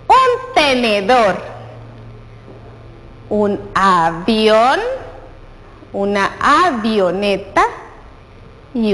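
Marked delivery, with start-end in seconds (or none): none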